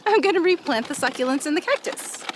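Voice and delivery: ordinary talk, nothing marked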